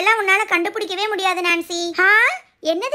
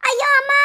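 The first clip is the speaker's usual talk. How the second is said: sudden high cry of pain; pained yelp; 6 semitones higher than usual. Acted. Hema cries out as she slips, pained yelp. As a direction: yelp out as if hurt. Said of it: "யார் அது ஐயோ அம்மான்னு கத்துனது".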